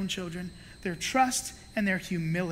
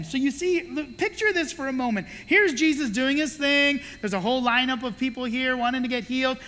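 ordinary talk, nothing marked

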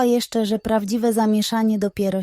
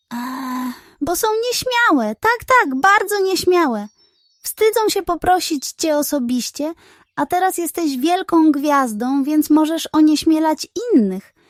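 high, hurried fib; fibbing pug; 5 semitones higher than usual. Acted. Pug invents an excuse to flatter the hen, high. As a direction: make it up on the spot, flattering.

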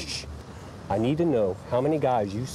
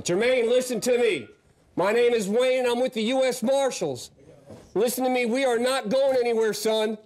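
calling out; 10 semitones higher than usual. Real, not acted. Negotiating, calling into the attic.

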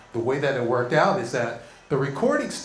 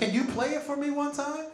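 put-on innocent kid voice; 11 semitones higher than usual. Kid asking teacher sweetly.

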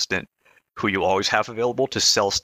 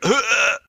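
loud high gagging sound; retching grunt; 14 semitones higher, 5 dB louder than usual. Retold or imitated. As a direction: fake a vomit noise. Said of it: "and was like".

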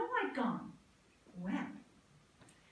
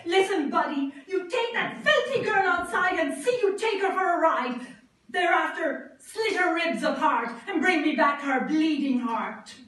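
loud bossy orders barked out; angry shout; 5 semitones higher, 11 dB louder than usual. A narrator's reading. Queen shouting orders at the huntsman, loud and angry. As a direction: bark commands at the huntsman. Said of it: "She shouted at him".

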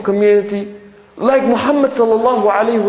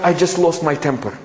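mock excuse; 6 semitones lower than usual. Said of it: "this we hear a lot".

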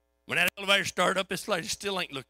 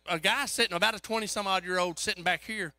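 usual speaking voice

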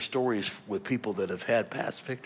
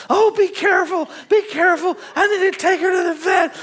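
high, loud panicked pleading; hammed-up urgent alarm; frantic worry; 11 dB louder than usual. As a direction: imitate an anxious wife in a fluster. Voices wife's frantic worry.

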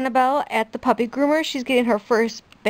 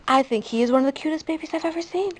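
ordinary talk, nothing marked

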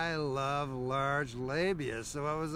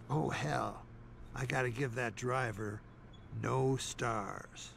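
usual speaking voice

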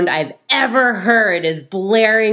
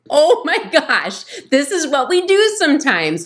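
laughing realization; 9 semitones higher than usual. Retold or imitated. Podcaster watching couple, laughing in recognition. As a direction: laugh at the irony. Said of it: "And I was like".

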